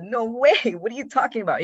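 high, loud laughing no way; shocked disbelief. Retold or imitated. Friend urging him to approach a woman, laughing shocked refusal. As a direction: pitch it up in startled disbelief. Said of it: "I said".

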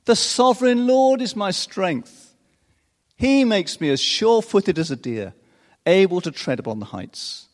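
loud, high, rising declamation; full-voiced triumphant reading; scripture reading. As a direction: proclaim it with building joy. Reads Habakkuk's song aloud.

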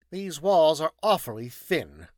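loud, high, peevish remark; tetchy scientist finding fault; petulant. Acted. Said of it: "he commented petulantly".

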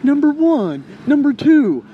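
high, loud rote counting; mock book teacher. Mimicking the book teachers students love, high mock-teacher call. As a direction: call out item numbers like a textbook teacher. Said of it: "They love the teachers that go page one".

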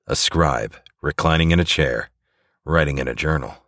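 usual speaking voice

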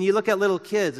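usual speaking voice

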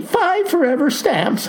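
high-pitched mock holler. A narrator's reading. Clerk finishes farmer's stamp request for him, hollering. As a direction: burst in high, without real volume. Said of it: "hollers she".

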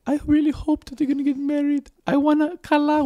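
high, far-off imitation of mom's Israeli accent; playful Israeli mom accent; imitating mom's accent. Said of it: "I love your attempt at her accent".

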